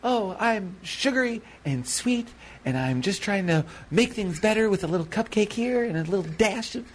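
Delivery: high sugary voice breaking into a fluttery "oh my"; sugary sweet baker. Retold or imitated. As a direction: play the dainty baker gushing over cupcakes. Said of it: "there's a sense of".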